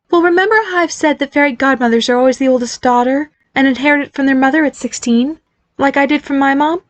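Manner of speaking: a mother gently explaining family magic; voiced mother. Mother explains glitter babies.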